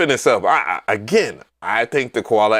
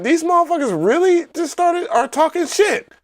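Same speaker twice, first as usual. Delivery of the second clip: high-pitched incredulous complaint; mock scandalized, pitched high throughout; retold disbelief. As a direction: act out being amazed at their nerve, voice way up. Retelling disbelief at online mockery.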